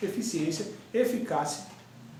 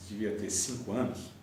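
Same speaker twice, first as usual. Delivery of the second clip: normal talking voice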